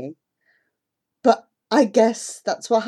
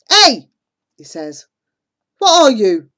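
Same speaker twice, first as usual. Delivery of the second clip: loud, higher mocking rebuke; angry scolding; 5 semitones higher, 8 dB louder than usual. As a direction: push her off the water. Man pushing her away.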